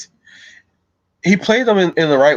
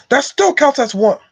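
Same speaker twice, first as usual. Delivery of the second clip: high, strained dwarf protest; Gimli; 3 dB louder than usual. Acted. Streamer retrying the dwarf's line, Gimli voice. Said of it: "let me try that again".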